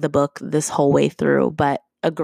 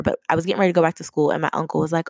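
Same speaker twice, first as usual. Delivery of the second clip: normal talking voice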